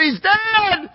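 high, shouted cry of grief; wailing mother. Acted. Egyptian mother running from her home, wailing shout. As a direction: scream it like a mother who found her son. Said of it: "crying and wailing and screaming".

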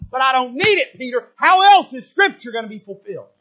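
Jesus sternly setting Peter straight; firm rebuke. Acted.

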